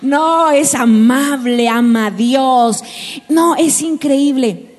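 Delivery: hammed-up infatuated gushing; mock dreamy girl. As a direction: drag it out like a lovestruck girl. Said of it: "que digo".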